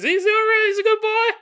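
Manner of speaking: put-on very high, loud country sheriff accent; sheriff's accent, exaggerated. Sheriff complimenting the dead man, hammed-up high country accent. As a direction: squeal the praise in a hammed-up rural accent. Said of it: "I don't think the sheriff shows up and compliments your son".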